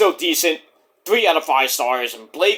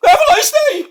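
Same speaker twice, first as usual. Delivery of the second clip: high, loud sarcastic goodbye; mock cheery farewell; 7 dB louder than usual. Skit character dismisses the other with a high, loud goodbye. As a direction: chirp it sarcastically.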